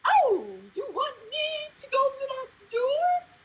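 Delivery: very high-pitched, wooden delivery; terrible actor. Retold or imitated. Bad actor asked to try another way, just as stilted. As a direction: imitate a bad actor failing to vary the line. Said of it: "try that another way".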